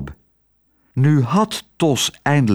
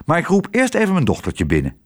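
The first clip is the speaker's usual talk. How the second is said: brisk, higher, cheerful voice; 6 semitones higher, faster than usual. A narrator's reading. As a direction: chirp it cheerfully and quickly. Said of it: "zei Tos vrolijk". (vrolijk en vlot)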